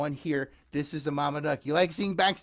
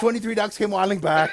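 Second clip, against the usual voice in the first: laughing; 5 semitones higher, 5 dB louder than usual. Acted. Silly twist, laughing.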